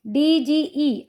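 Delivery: the letters डीजीई drawn out slowly, ending on a fall; slow spelling out. Shown as a demonstration. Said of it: "अब इसको कैसे पढ़ेंगे".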